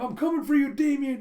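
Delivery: a loud, high heroic call; heroic shout. Retold or imitated. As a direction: yell it as you dive in. Jeff dives to rescue.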